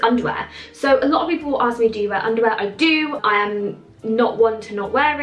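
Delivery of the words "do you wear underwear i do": ordinary talk, nothing marked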